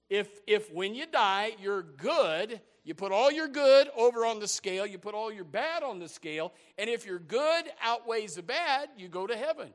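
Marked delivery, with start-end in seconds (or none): none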